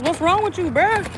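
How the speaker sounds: high, exasperated what's wrong with you; exasperated mom. Retold or imitated. Mom to her toddler who starts tripping, high and exasperated. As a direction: squeak it up at a toddler who's tripping. Voicing herself scolding toddler.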